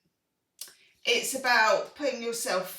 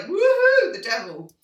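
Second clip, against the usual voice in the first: mock cheer; 8 semitones higher, 11 dB louder than usual. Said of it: "Lesser star signs might be like".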